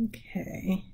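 unvoiced whispered okay; whispered self-cue. Real, not acted. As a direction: mouth it softly to yourself. Aside to herself.

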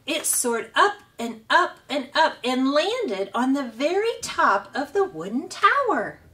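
plain reading voice

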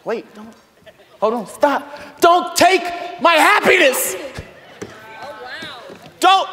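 high, loud pleading shout; mock protest. Pastor pretending to fight over the church, shouting high. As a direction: clutch it and refuse to let go. Acted tug of war.

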